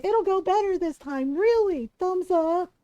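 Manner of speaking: high, loud, chirpy mock optimism; mocking Solas. Fan parodying Solas's cheery promise, high and loud mockery. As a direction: parody his breezy confidence. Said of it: "He's like".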